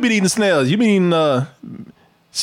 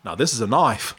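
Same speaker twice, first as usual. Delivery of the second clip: low mock Australian accent; Crocodile Dundee; 8 semitones lower, 4 dB quieter than usual. Shown as a demonstration. Host demonstrating the film line, low Australian accent. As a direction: answer low in Australian, broad "knife". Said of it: "try some old Crocodile Dundee".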